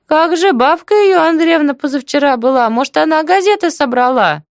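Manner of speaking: kindly neighbour, pitched right up; chatty deaf auntie, understanding. Acted. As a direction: prattle on in a high voice. Story figure: aunt answers.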